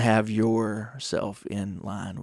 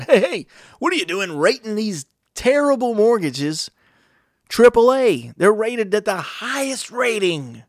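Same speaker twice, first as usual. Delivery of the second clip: higher, outraged grilling of the agency; mock outrage at the hearing; indignant questioning; 9 semitones higher, 5 dB louder than usual. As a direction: demand answers like an angry congressman. Congress grilling the rating agency.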